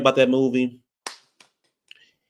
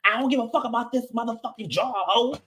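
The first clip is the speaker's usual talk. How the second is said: much higher, sassy brush-off; mock defiant; 9 semitones higher than usual. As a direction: imitate her not caring at all. Imitating the defiant bank character.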